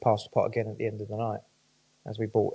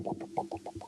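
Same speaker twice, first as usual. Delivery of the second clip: soft voiceless pops like a pendulum ticking; 12 dB quieter than usual. Shown as a demonstration. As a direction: tap out the pendulum beat under your breath. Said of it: "like a pendulum".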